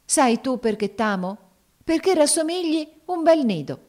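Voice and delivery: high, rapturous declaration of love; storybook lover in ecstasy; ecstatic. A narrator's reading. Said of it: "esclamò a un tratto con estasi".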